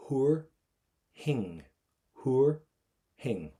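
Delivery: huair thing in two separated beats, "HOOR... hing"; silent TH. Shown as a demonstration. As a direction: break up huair thing, first part high and loud, TH as plain H. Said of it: "Do not pronounce the T H in Irish".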